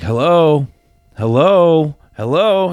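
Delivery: repeated hello. Retold or imitated. Answering silent call, repeating.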